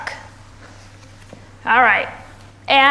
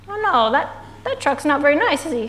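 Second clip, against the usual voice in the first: usual speaking voice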